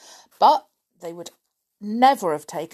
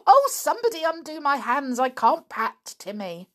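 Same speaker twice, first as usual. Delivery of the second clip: eager; 8 semitones higher, 4 dB louder than usual. Acted. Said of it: "she said".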